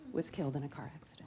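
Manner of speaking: barely voiced, heavy with grief; hushed whisper. Real, not acted. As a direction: drop to a near whisper on the loss. Grief in family tragedy story.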